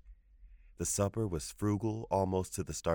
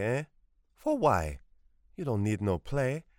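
put-on raised, loud old woman's rebuke; stern scolding; 5 semitones higher, 4 dB louder than usual. A narrator's reading. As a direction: shut him down firmly. Grandmother scolding.

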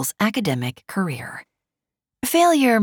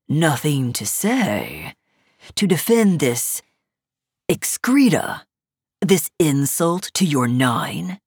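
slow, lower pressing sneer; lazy arrogant nobleman; 3 semitones lower than usual. A narrator's reading. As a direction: press her with slow disdain. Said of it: "Toxon pressed".

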